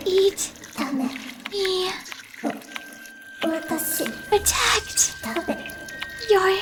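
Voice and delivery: high, soft eerie whisper of a doll; faint ghostly doll voice. Acted. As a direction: breathe it like a dying ghost. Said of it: "Her voice grows silent".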